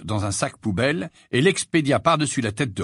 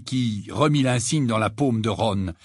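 usual speaking voice

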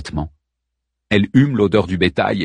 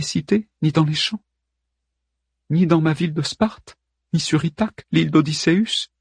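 Helen's voice; 8 semitones higher than usual. Acted. Helen questions Hockenberry.

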